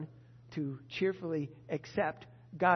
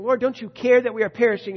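loud, anxious demand of the Lord; accusing complaint; 4 semitones higher, 12 dB louder than usual. Shown as a demonstration. Preacher mimics the agitated disciple's accusing question. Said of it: "So instead of saying".

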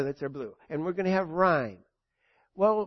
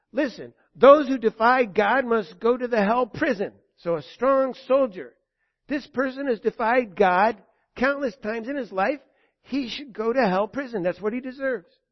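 louder, firm prosecutor's demand; hammed-up stern soldier; 4 semitones higher, 6 dB louder than usual. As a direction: lay down the sentence like a hard soldier. Strong soldier Righteousness demands hell.

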